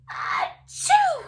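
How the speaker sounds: huge sneeze. Acted.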